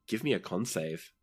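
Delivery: normal talking voice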